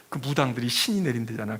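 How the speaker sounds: ordinary delivery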